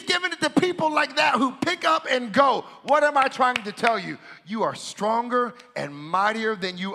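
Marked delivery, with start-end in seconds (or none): none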